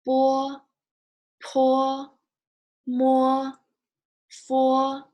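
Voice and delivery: teacher leading a pinyin drill; Mandarin initials drill. Shown as a demonstration. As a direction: call out Bo, po, mo, fo crisply for repetition. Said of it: "we'll say it one more time from here".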